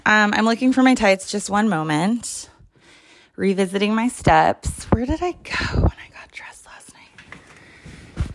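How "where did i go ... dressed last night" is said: breathless searching whisper, slow and high; a puzzled whisper under her breath; whisper to herself. Real, not acted. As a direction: hush it out as you retrace your steps. Hunting for tights, muttering to herself.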